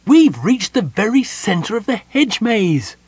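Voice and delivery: high, loud, slow triumphant announcement; ecstatic gnome. A narrator's reading. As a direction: proclaim it with overflowing joy. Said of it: "the gnome shouted ecstatically".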